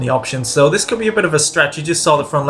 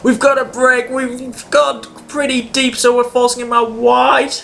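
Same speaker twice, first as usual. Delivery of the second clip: higher, urgent race-radio energy; tense excitement; 7 semitones higher than usual. Real, not acted. Gamer fending off Massa on last lap, higher tense excitement. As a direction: call it out like the lead is at stake. Defending position on final lap.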